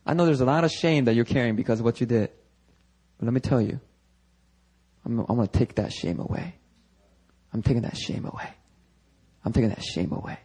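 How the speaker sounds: soft, low, consoling voice; acted gentle reassurance. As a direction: quietly lift the burden from them. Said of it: "Jesus is like".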